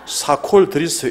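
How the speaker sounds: loud, drawn-out mock offer; announcing the drink. Colleague serves the preacher soda mixed with cola, announcing it loudly. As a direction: stretch it out loudly for laughs.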